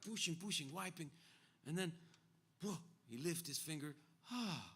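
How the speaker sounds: imitated friend reliving it in high-pitched bursts; Ruben. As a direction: build the pushing, then settle in amazement. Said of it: "This is how Ruben told it to me".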